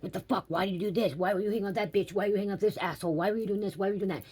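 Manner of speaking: high-pitched accusing rant, fast in the middle; retold angry yelling. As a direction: fire the questions at him. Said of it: "I'm yelling at him".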